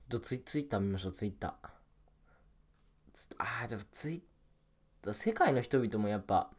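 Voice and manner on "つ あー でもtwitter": whispered aside to yourself. Real, not acted. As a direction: breathe the words without voice. Muttering to self while browsing. (ささやき声で)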